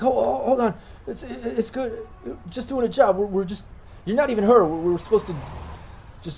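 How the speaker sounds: high-pitched, backpedaling henchman; high, hurried, apologetic pleading; wounded thug pleading. Acted. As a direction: talk your way out in a high voice. Voices the wounded man explaining himself.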